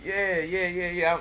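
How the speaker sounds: real high-pitched greeting chatter; calling out a reply. Real, not acted. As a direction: call back eagerly to someone passing. Answering the passing former coworker.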